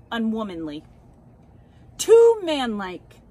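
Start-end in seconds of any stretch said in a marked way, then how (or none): 2.0-3.2 hammed-up outrage, slowed and loud; pompous club men. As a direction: boom it out like a scandalised gentleman's club. Club men condemn a woman racer.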